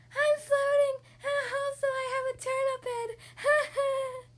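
high, loud silly pig, rising with glee; giddy dreaming pig. Acted. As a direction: giggle at the absurd dream. Piggy dream laughing.

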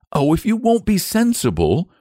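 put-on high-pitched boy losing patience; exasperated cry. A narrator's reading. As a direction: cry it as a fed-up boy, without shouting. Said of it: "cried the boy".

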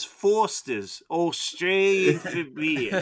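slow mock Australian ad line; Australian accent. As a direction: parody an Aussie beer slogan. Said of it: "killing with the Australian accent".

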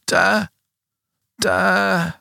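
high, drawn-out feeble call; distant cry. Retold or imitated. Son calling out from the brush, imitated high cry.

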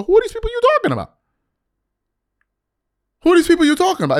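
very high, incredulous demand; indignant challenge. Acted. Host echoing Perkins pushing back on LeBron, indignant. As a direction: throw the question out high and insistent. Acting out demand to name names.

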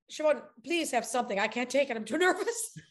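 fretting host begging; Lars pleading. Retold or imitated. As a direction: beg her to take a drink. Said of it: "Lars pleading".

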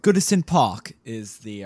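put-on a light British accent on the stadium name. Naming Everton's stadium for his co-host, in a light British accent. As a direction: say the ground's name like a Brit, lightly. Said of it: "I will just say in the British accent".

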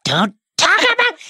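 high piercing shriek; shrieking witch. Acted. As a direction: scream at him to stop. Acted Bellatrix scream.

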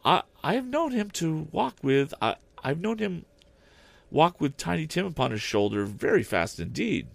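high mother's voice, cheerful with one falter; steady cheerful voice, faltering once. Acted. Mrs Cratchit telling her children of Tiny Tim, cheerful but faltering. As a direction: keep it bright and steady, stumbling once. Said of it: "in a steady, cheerful voice that only faltered once".

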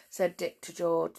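ordinary delivery, nothing marked

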